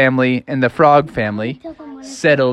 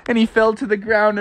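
usual speaking voice

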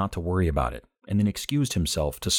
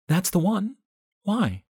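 much higher, slow, gentle woman's voice; young woman June; 13 semitones higher, slower than usual. A narrator's reading. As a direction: voice June high and dreamy.

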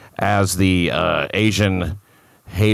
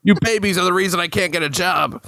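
high-pitched mock-angry outburst; pissed off; 8 semitones higher than usual. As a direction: rant in a hammed-up, indignant high voice. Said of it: "So pissed off".

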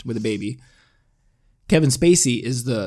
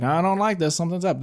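higher-pitched, horrible attempt at the actor; badly missed imitation, pitched up; Morgan Freeman; 5 semitones higher than usual. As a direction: do a bad celebrity impression. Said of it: "That's a horrible Morgan Freeman".

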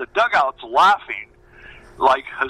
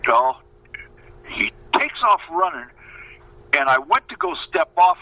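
ordinary talk, nothing marked

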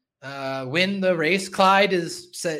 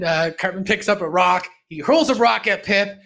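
ordinary delivery

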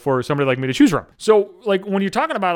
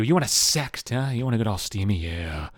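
sinking into a low, soft, sultry murmur; phone sex voice; 9 semitones lower, 8 dB quieter than usual. Pretend. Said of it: "I'll bring out fucking phone sex voice".

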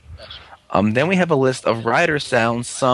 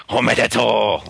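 lightly voiced creepy villain. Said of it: "that creepy voice where he's like".

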